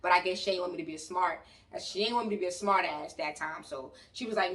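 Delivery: normal talking voice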